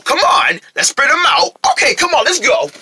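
usual speaking voice

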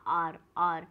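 आर said as a short "aar" with no "hour" glide; correct pronunciation. Shown as a demonstration. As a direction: say आर as one clipped syllable, aar. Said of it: "सही जो pronounce होता है".

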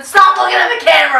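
loud. Real, not acted. Loud scolding friend.

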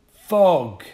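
high, loud one-word comeback; retort. Acted. Paperboy corrects Bennett, firm and loud. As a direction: correct him firmly. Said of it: "the boy retorted".

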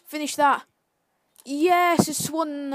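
loud cheer as the shot goes in; excited shout. Real, not acted. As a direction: yell it, urging the striker. Cheering the striker on.

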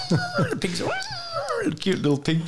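ordinary delivery

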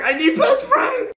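giddy laughing yell; laughing shout. Real, not acted. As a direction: blurt it out laughing. Excited over creepy friend.

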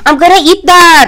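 loud, high, eager cry; excited shout. Real, not acted. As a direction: shout it out with glee. Excited grab at the cake.

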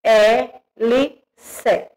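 slow, spaced letters "H E L C"; teacher spelling out "H E L C" slowly. Shown as a demonstration. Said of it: "Hélice".

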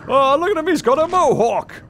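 high, loud burst of delight; delighted excitement. Real, not acted. Player spotting the wolf's mohawk, excited and delighted. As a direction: exclaim it like you've spotted something adorable.

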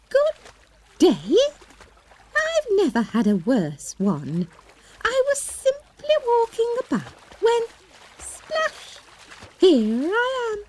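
high, squeaky, indignant little mouse. Acted. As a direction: complain like a tiny grumpy mouse. The grumpy mouse complains.

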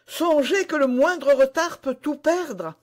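high, loud lady's warning; urgent cry. A narrator's reading. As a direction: cry out urgently, pitched right up. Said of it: "s'écria Milady".

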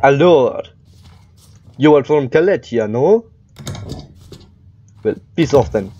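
wobbly mock Russian accent; drifting Russian accent. Inspector turning away a Kalechian, drifting Russian accent. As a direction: play the guard in an unsteady fake Russian accent. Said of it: "drifting into a different accent".

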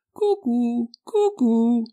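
high, hooting cuckoo imitation; cuckoo call. Maid in feathers on the roof faking a cuckoo call. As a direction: sing out like a cuckoo. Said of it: "andò in cima al tetto a cantare".

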